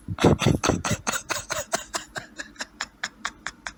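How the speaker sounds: long, quiet wheezing giggle; soft breathless laugh that runs on; stifled laugh. Real, not acted. Giddy at the preview.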